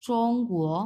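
চং flat first tone, কুয়ো rising second tone; level চং, rising কুয়ো; Chinese tones. Shown as a demonstration. Teacher repeating চং কুয়ো with tones. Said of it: "so কুয়ো এরকম".

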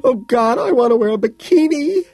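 very high, loud hysterical wail. A narrator's reading. Woman choking on tears, wailing. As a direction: wail it out in despair. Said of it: "she wails".

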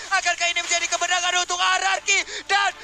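high, loud shouted hype call; esports caster hype. Real, not acted. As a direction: call the win at full shout.

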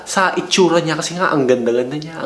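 usual speaking voice

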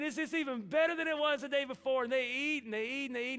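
ordinary talk, nothing marked

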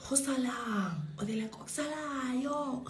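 sing-song mocking taunt. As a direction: jeer at the loser. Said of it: "when someone loses a fight, they're like".

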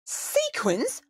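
high rising shock; outraged disbelief. Acted. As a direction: gasp it out in disbelief.

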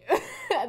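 high burst of giggling; giggle. Real, not acted. Giggling at her own word. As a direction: laugh at the word you just said.

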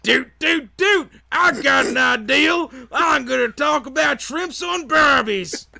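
put-on loud, high shouting Australian accent; hammed-up Australian surfer accent. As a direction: yell it like an overexcited Aussie bloke. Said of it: "do your best Australian accent".

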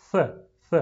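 "ث" as English th, tongue tip at the front teeth; a short, separate "ث" th sound, twice; isolated th sound. Shown as a demonstration. Teacher shows pupils the tongue position, isolated th sound. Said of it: "باید نوک زبانتون به نوک".

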